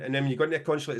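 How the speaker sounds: usual speaking voice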